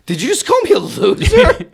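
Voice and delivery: very high, loud disbelief; incredulous outburst. Retold or imitated. Presenter confronting the kid who heckled him, incredulous high yelp. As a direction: squeal the question in mock outrage. Retelling stunned reply to heckler.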